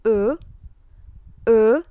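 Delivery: "E" as a rounded French "euh", loud; French letter E. Shown as a demonstration. Teacher showing students how E is read, French letter sound. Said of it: "huruf E dibacanya".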